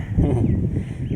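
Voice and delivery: loud delighted laugh; gleeful laugh. Acted. Hema laughs happily as the witch takes Vipin. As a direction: burst out laughing with glee. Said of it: "Hema खुशी से बोलती है".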